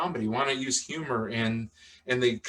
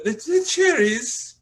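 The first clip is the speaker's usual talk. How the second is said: put-on high old lady voice; 4 dB louder than usual. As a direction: squeak it out like the old dame. Replaying the old lady's line.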